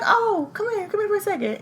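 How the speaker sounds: high sweet-talk, imitated coaxing; nice and smooth. Mother luring boy out, put-on niceness. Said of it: "trying to be nice and smooth".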